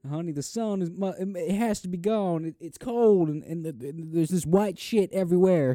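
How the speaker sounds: high, loud, frantic husband; hysterical panic. Retold or imitated. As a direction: tell your wife the world is ending, frantic. Mock Texan panic over snow.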